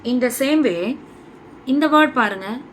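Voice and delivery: usual speaking voice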